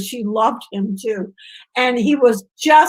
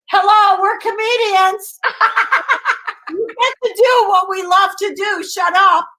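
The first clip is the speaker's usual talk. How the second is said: George's voice; 6 semitones higher, 3 dB louder than usual. Retold or imitated. George imitated joking with manager.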